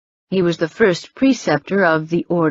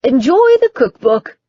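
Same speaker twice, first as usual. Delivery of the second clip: raised, calling after someone; called out; 6 semitones higher, 5 dB louder than usual. A narrator's reading. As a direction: call it down the hallway. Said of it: "the rabbi called out".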